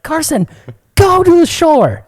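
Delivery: high, loud, drawn-out yell; retold shouted order. To brother in the canoe, urgent shouted order. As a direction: holler the order slowly and urgently.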